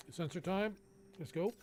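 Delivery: usual speaking voice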